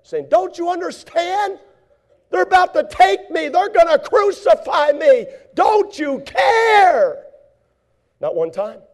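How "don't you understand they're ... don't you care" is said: high, loud, tearful shouting complaint; pretend angry cry. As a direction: cry out as if betrayed. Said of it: "never one time did he get mad".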